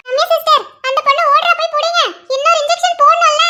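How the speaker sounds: high-pitched, urgent call for help. Acted. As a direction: call after someone who is getting away. Calling nurse after fleeing patient.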